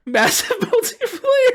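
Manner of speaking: high, laughing echo of the phrase; incredulous giggle on the words; laughing disbelief. Real, not acted. Incredulous reread through laughter.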